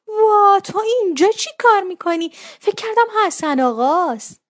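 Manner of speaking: high, loud exclamation of surprise; startled surprise. A narrator's reading. Mother finding her daughter at the door, startled.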